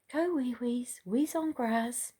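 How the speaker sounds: high sing-song potty command; coaxing a puppy. Retold or imitated. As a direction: coax the puppy to pee, lilting.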